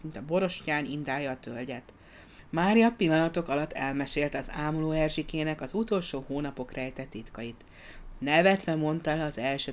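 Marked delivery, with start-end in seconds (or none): none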